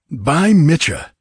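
loud, high, drawn-out exclamation; astonished oath. A narrator's reading. Horseman staring up at the crucified man, astonished. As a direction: cry out a slow, amazed oath.

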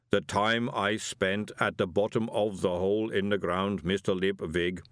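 slow, measured golem voice; placid golem. Acted. Golem correcting Moist, placid and slow. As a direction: answer ponderously, evenly weighted.

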